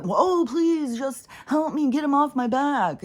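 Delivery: high, whiny mock plea; mock pleading. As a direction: parody a helpless whiner. Mocking people who beg.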